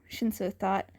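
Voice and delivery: ordinary delivery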